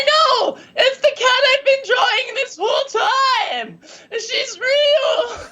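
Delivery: very high, hammed-up crying shout; mock tearful wail. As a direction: wail it as if overcome with joyful tears. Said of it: "I'm, like, covered in tears".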